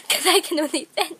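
laughing through the words; giggling as she says it. Real, not acted. Laughing while telling about her potato video.

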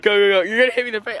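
ordinary talk, nothing marked

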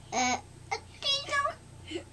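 very high, crying squeak; alarmed surprise. Acted. Thomas hears about the diesel, alarmed squeak. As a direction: gasp it in shock.

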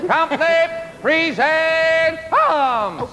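slow, high drawn-out parade order; barked firing squad commands. Acted. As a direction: stretch the command out like a drill sergeant. Captain drilling his crew.

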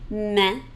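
Main with nasal E, no n; French main. Shown as a demonstration. Teacher modeling main, nasal E. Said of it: "spelled A I N".

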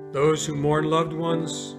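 usual speaking voice

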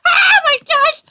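high, loud shriek of alarm; alarmed outburst. Real, not acted. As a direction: cry out in sudden game panic. Sudden alarm in the game.

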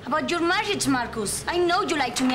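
angry accusation. Acted. Woman confronting Marcus about his hidden marriage, heated. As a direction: throw the charge at him, pitched high.

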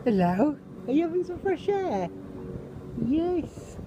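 high sing-song coo. Real, not acted. Cooing at Chloe.

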